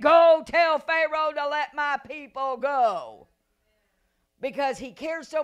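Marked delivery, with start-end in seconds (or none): 0.0-3.3 loud divine command. Retold or imitated. God commanding Moses.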